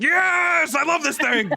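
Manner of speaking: high shouted ogre joy; thrilled ogre. Retold or imitated. Ogre lifts the caster and cheers, high joyful shout. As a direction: shout it in glee. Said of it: "and he's like".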